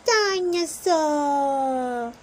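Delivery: put-on slow, drawn-out toddler shout of dinosaur; a tiny boy yelling his favourite word; high baby shout. A narrator's reading. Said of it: "he shouts".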